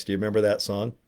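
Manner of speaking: usual speaking voice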